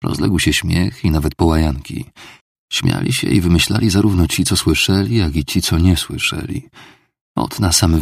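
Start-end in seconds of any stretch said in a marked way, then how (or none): none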